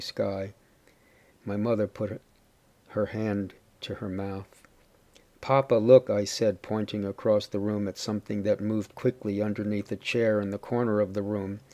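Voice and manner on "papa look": put-on a child's sudden alarmed call, a little louder; mild alarmed cry to papa; urgent call. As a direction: point it out fast, startled. Child alerting father to rat.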